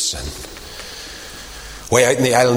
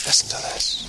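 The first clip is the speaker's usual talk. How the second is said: hushed; 7 dB quieter than usual. Shown as a demonstration. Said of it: "repeated ever quieter".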